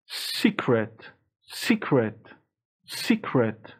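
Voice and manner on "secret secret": normal talking voice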